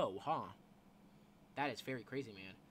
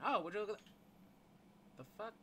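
usual speaking voice

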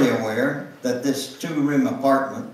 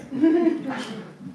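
real laugh. Real, not acted. Reader laughs after line.

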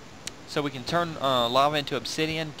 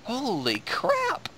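surprised; 10 semitones higher, 4 dB quieter, much slower than usual. Real, not acted. Six diamonds found.

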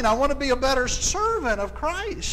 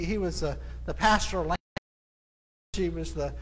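normal talking voice

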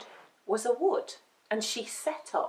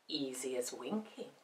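low, very soft cat voice; smooth, secretive witch's cat; sly cat; 10 semitones lower, 14 dB quieter than usual. A narrator's reading. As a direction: offer it coolly, low and quiet.

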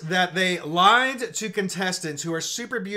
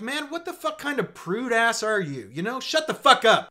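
normal talking voice

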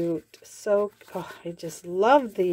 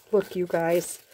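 normal talking voice

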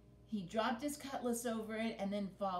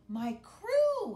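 joyful exclamation; 11 semitones higher, 10 dB louder than usual. Acted. Captain overjoyed seeing his crew.

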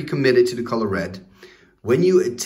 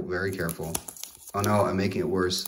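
softer, under the breath; quiet; 4 semitones lower, 6 dB quieter than usual. Real, not acted. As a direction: hush it to yourself carefully.

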